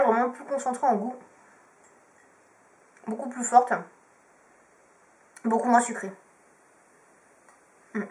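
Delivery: chewing a jelly bean; speaking with candy in mouth; talking while chewing. Real, not acted. Seen 0: Bean put in mouth at -9; chewing motions between phrases.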